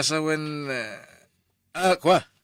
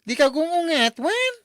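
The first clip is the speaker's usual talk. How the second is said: excited teasing; 9 semitones higher, 5 dB louder than usual. Acted. Office mate teasing excitedly.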